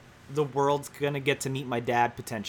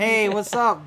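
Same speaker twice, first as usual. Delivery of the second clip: upbeat salesman greeting; 7 semitones higher, 7 dB louder, slower than usual. Retold or imitated. Acting out selling door to door.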